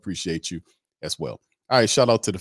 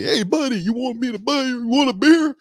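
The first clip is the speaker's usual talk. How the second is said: much higher, louder cheery fan voice; friendly fan; 6 dB louder than usual. Retold or imitated. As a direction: play the beer-buying buddy at the game, high and bright. Imitating a friendly fan at games.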